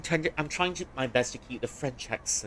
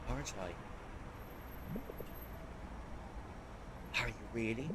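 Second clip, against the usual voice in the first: hushed, grieving; 13 dB quieter than usual. Acted. Emotional reunion scene, acted.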